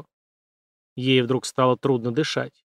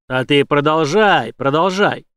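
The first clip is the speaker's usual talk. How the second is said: mocking command; 6 dB louder, slower than usual. A narrator's reading. Villain orders her on.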